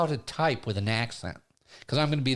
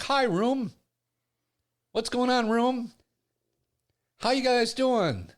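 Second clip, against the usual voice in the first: eager hello; 8 semitones higher, slower than usual. Retold or imitated. Eager greeting in chat room.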